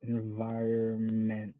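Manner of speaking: slow careful try. Shown as a demonstration. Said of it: "I don't even know how to say it".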